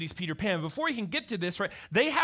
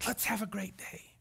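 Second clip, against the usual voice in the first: put-on high, slow, sing-song greeting to a small child; teacher kneeling to greet a child at the classroom door; preschool teacher voice; 10 semitones higher, slower than usual. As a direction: crouch down and beam at a toddler. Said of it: "get down here with the child".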